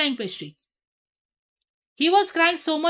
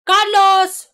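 loud; 5 semitones higher, 9 dB louder than usual. Real, not acted. Greeting a viewer arriving.

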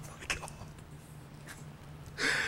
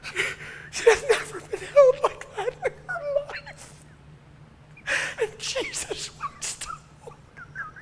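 much higher, crying and shouting; 14 semitones higher, 7 dB louder than usual. Real, not acted. Preacher weeps telling the congregation about her, crying. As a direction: let the sobs break through the words.

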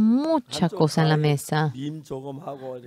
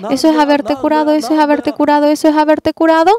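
high, sarcastic wife growing louder to the end; a wife taunting her husband, building with each repeat; mocking wife; 9 semitones higher, 3 dB louder than usual. Wife mocks his claimed healing.

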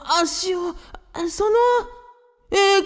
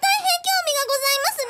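much higher, fast, squeaky put-on character voice; much faster than usual. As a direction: jump way up into a quick, strange character voice. Margarita speaks in her odd voice. (甲高く早口の作り声)

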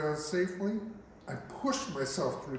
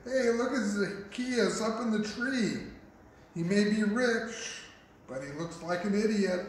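higher, jeering call to the others; mocking onlooker; 6 semitones higher than usual. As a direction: poke fun at the man up the tree. Crowd mocks him in the tree.

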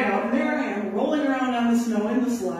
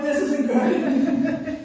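drawn out very slow through a laugh, higher; laughing dismay; 7 semitones higher, 4 dB louder, much slower than usual. Retold or imitated. Musher watching sled runners fly, slow laughing dismay. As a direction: stretch it out while laughing. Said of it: "and I'm like".

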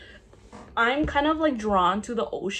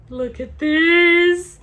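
excited awe; 7 semitones higher, 9 dB louder, much slower than usual. Real, not acted. Shows off her favourite bag.

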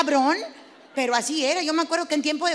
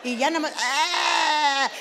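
imitated cry; 4 dB louder, slower than usual.

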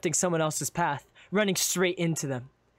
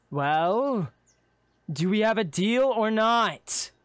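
higher, louder, drawn-out demand; impatient; 7 semitones higher, 4 dB louder, slower than usual. Acted. Cloaked man waiting for your handshake, impatient. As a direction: press hard for an answer. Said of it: "would grow impatient".

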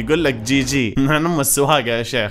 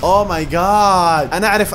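surprised; 6 semitones higher, 6 dB louder, much slower than usual. Real, not acted. Shocked by koala sleeping fact.

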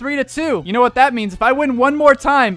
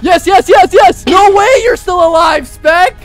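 high, loud rapid-fire cheers into disbelief; excited shouting; 6 semitones higher, 9 dB louder, slower than usual. Real, not acted. Player nearly finishing rival, excited shouting. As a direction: yell as victory slips away. Near win in the fight.

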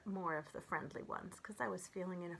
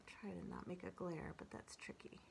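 whispered aside; 2 semitones lower, 11 dB quieter than usual. Real, not acted. Whispered aside fixing camera glare.

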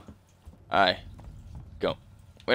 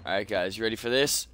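ordinary talk

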